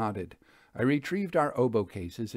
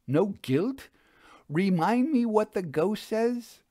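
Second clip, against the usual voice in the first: high, louder, baffled objection; indignant protest; 9 semitones higher, 4 dB louder than usual. A narrator's reading. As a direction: protest that nothing adds up, pitch climbing. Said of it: "she protested".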